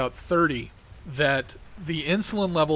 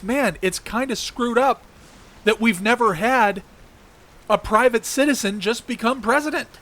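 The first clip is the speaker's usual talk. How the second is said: quoted thought; 7 semitones higher, 3 dB louder than usual. Retold or imitated. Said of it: "Nobody considers".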